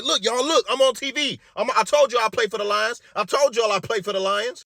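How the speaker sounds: high, loud hollering to the patients; mock hollering. Patient hollers to other patients, mock loud call. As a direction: call everyone over to see. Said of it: "louder than ever before, hollering".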